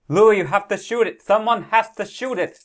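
high-pitched, pleading shout to a companion; urgent yelling. Acted. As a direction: yell an urgent order in character. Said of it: "so you're yelling at him".

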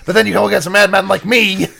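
hammed-up wrestler promo voice; Macho Man. Co-host riffing on three-on-one odds, hammy wrestler impression. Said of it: "You're doing a Macho Man voice".

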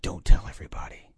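hushed whisper. Retold or imitated. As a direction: whisper it so no one hears. Mom hushing the others.

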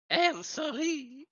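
affected, formal foreign character voice; visiting foreign journalist. Acted. Foreign journalist asking staff for the key, affected and polite. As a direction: put on courtly foreign politeness.

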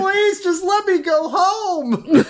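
high, drawn-out wail; mock begging. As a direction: plead slowly to be let go home. Acting a desperate guest begging.

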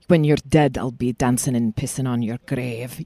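Scottish burr on a reset line; Scottish accent. Shown as a demonstration. Actress showing the line that resets her accent, Scottish accent. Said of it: "if I'm gonna do, like, Scottish".

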